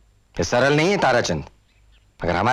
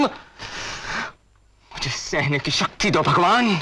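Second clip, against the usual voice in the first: slow, raised, broken-hearted prayer; anguished plea; 5 semitones higher, much slower than usual. Acted. Grieving man praying for strength to bear it, anguished. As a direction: beg God for strength, drawn out.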